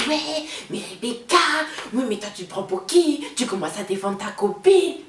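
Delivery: a hint of the mother's mocking tone; lightly voiced mocking retort; quoting the mother.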